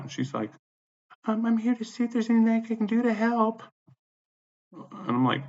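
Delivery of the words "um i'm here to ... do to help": high-pitched mocking falsetto; property manager impression. Property manager offering help at the door, mocking falsetto. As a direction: mimic her offer in a put-on high voice.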